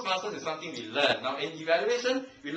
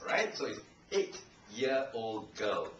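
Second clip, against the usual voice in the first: normal talking voice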